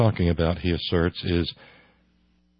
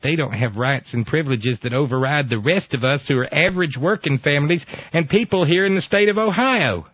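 mock Ohio activist, rising in pitch; ignorant Ohio voice; 5 semitones higher than usual. Host quotes activist's complaint, ignorant Ohio voice. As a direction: build it higher in an ignorant Ohio accent. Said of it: "my ignorant Ohio voice".

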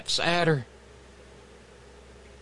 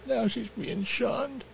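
mock sad; 9 dB quieter, slower than usual. Mock sorrowful aside.

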